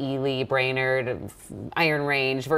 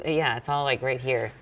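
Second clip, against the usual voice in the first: ordinary delivery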